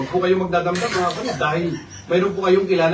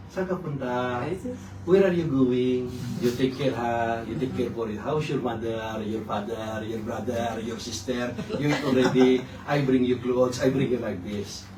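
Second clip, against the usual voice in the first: mock sweet suitor; 7 semitones lower, 6 dB quieter, slower than usual. Imitating sweet-talking suitor in broken English.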